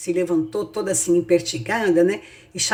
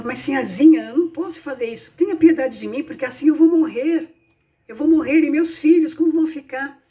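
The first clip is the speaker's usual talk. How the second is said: high-pitched, urgent acted plea; desperate pleading; 7 semitones higher than usual. Young mother begs the sinhazinha for her life, desperately pleading. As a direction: beg for mercy, voice raised in pitch.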